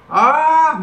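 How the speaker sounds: sharp frightened yelp; startled scream. Real, not acted. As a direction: jump and yell out in fright. Sudden scare in game.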